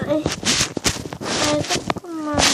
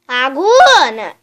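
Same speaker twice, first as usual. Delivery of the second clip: loud slow call across the house; 4 semitones higher, 10 dB louder, slower than usual. Acted. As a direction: call her name from afar. Calling a friend from afar.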